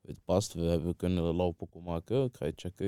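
ordinary delivery

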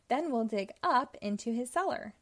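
ordinary delivery, nothing marked

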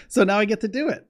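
super happy. Real, not acted. Telling viewers about his move, real excitement. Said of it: "I'm like a little kid, you know, I'm super happy".

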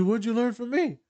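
high, lightly voiced client; curious high question. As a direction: pitch it up as the client.

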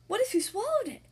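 gasp of alarm. Acted. Amelia fears swallowing tooth.

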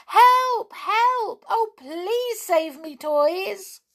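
high, loud, frightened cries for help; screaming for help. A narrator's reading. Fairy doll screams to the toys while carried off. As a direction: scream it out in terror, pleading. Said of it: "How she screamed".

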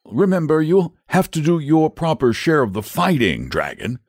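high-pitched knight giving a reminder; insistent knight. Acted. Saint George reminds the dragon of his share, insistently.

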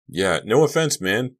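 high, loud agreeable echo; eager echo. A narrator's reading. Bugner and Henderson echo Malik's apology, chiming in. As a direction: chime in right after the leader. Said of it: "Bugner and Henderson echoed".

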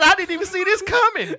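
high mocking quote voice; mocking Luke. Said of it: "He said".

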